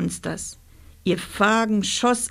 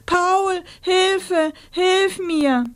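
calling for help; 11 semitones higher, much slower than usual. Acted. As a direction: call out desperately from above. Rosa calls for help.

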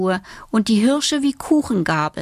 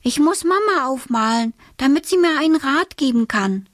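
put-on high, quick girlish voice; bright child's voice, lifted high; little girl Alice; 6 semitones higher, faster than usual. A narrator's reading. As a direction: play a pleased little girl.

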